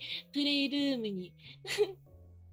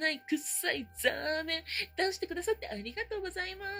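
usual speaking voice

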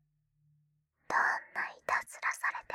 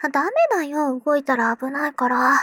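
louder, teasing warning; playful scolding; 10 dB louder than usual. Acted. Little sister telling squirming brother to hold still, mock scolding. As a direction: scold him lightly so he stays still.

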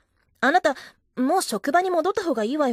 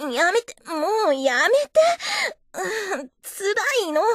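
crying plea; 7 semitones higher than usual. Acted. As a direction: cry out for him to stop. She pleads loudly.

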